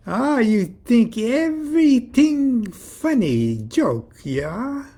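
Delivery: put-on high, loud, slow European accent; hammed-up indignant European foreigner; phony European accent. A narrator's reading. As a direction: stretch it out in a fake European accent. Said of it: "The phony accent".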